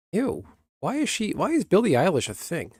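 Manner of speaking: high, disgusted whine. Real, not acted. Streamer scoffing at a pop star, high and disgusted. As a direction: sneer it out in disbelief. Disgusted rant about a celebrity.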